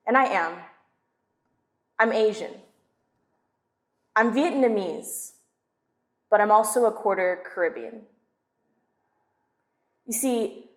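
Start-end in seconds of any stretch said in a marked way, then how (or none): none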